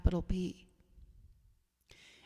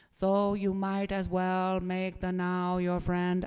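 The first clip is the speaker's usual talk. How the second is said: slow German-accented impression; measured German accent, lightly mimicked; Eckhart Tolle; 4 dB louder, slower than usual. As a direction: slowly imitate a German accent, each word drawn out. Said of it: "I should say it in his accent".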